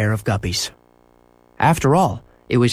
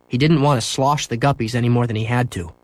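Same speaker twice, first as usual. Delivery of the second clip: ordinary delivery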